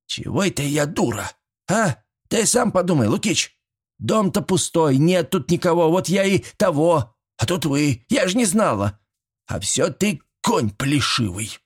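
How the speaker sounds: high-pitched granny defending herself; feisty old woman, flustered. A narrator's reading. Old woman protests she is no fool, flustered.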